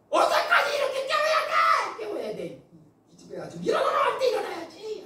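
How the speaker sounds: half-laughing imitated angry mother yell; angry mother scolding. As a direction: rant like a furious mom holding a kitchen knife. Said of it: "그 엄마는 화가 나 있잖아요".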